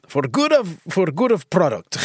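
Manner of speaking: hammed-up amateur Russian accent. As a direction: try a rough Russian accent. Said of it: "I can't do a Russian accent".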